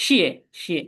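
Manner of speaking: first শিয়ে falls hard, second is light; শিয়ে dropped sharply, then শিয়ে short and unstressed; falling then neutral tone. Shown as a demonstration. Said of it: "flat tone যেটা volume tone নিচে পড়বে".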